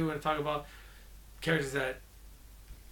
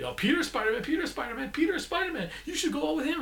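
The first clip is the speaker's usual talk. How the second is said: high mock chant, climbing higher on each repeat; mocking sing-song snitch; 12 semitones higher, faster than usual. Vlogger mocks the friend outing Peter, rising high sing-song. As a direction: tease it like a kid tattling, rising each time. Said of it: "He might as well have just said".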